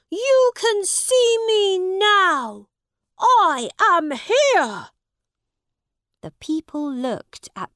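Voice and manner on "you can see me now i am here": high, loud, drawn-out angry call. A narrator's reading. Prince presenting himself to the crowd, angry. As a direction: call out angrily and slowly. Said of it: "he said angrily".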